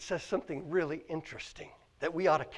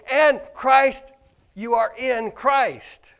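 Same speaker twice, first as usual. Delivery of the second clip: loud, high fervent preaching; 8 semitones higher, 12 dB louder than usual. Acted. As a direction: preach it out to the church. Preaching at full force.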